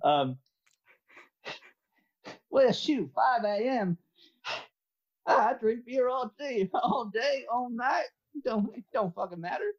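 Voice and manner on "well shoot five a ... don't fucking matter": Southern guy. Acted. Southern guy defending early drinking to a tea drinker, high Southern accent. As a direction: shrug it off as a beer-loving Southerner. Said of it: "you're the Southern guy".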